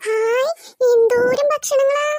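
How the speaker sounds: high, drawn-out excited exclamation; delighted amazement. Acted. Child seeing so much food, thrilled and drawn out. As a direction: stretch it out in wide-eyed wonder.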